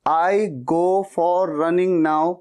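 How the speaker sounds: very slow, halting beginner English; slow beginner take. Shown as a demonstration. Beginner's first slow try at the sentence. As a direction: drag each word like a first-timer. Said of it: "पहले शुरुआत में तो शायद आप ऐसे बोलेंगे".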